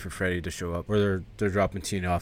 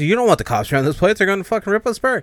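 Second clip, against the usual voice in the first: panicked; 10 semitones higher, 5 dB louder, faster than usual. Retold or imitated. Frank panicking about the cops.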